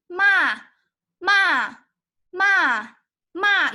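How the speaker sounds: MA starting high and loud, falling sharply; fourth tone. Shown as a demonstration. Teacher drills the fourth tone, loud and falling. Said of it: "going down".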